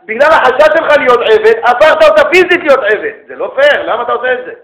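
loud, high scolding retold to the class; retold rebuke. Rabbi retells rebuking student, loud and high. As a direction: scold him as you did then. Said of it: "אמרתי לו".

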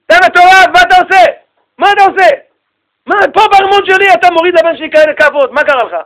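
high, loud incredulous shouting; hammed-up furious father yelling; outraged king. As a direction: demand answers at full volume. King confronts sage who beat his son.

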